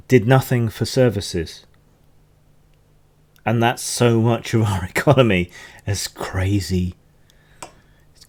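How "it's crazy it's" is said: whispered aside; whispering. Real, not acted. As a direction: breathe it under your breath. Aside under the breath.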